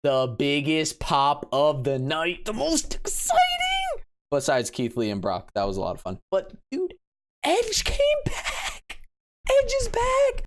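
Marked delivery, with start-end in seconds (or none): 2.4-4.0 a much higher shout of hype; excited shout. Real, not acted. Hyping Edge's surprise return. 6.3-10.4 much higher, slow, stunned excitement; thrilled disbelief. Real, not acted. Hyping Edge's surprise return.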